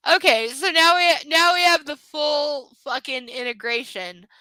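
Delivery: loud, high, exasperated commentary; exasperated outburst. Real, not acted. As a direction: announce it loudly, fed up. Exasperated at the tangled plot.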